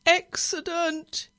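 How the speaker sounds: slow, high, loud groan of dread. A narrator's reading. Nervous driver starting the engine without his feather, groaning. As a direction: draw the word out in a loud groan. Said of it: "he groaned as he started the engine".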